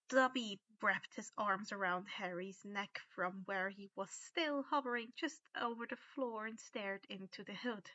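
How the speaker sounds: plain reading voice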